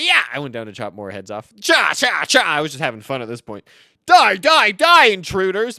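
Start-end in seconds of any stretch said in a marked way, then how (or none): 1.6-2.4 high, loud mock karate chops; shouted chop noises; playful chopping cries. Chopping heads, having fun. 4.1-5.8 high, very loud playful yell; hammed-up attacking holler; mock battle cry.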